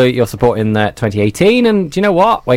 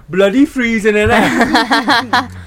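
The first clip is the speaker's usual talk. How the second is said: quoted line; 8 semitones higher, much slower than usual. Retold or imitated. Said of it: "I love the end of that".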